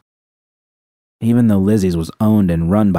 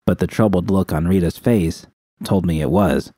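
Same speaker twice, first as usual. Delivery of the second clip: ordinary delivery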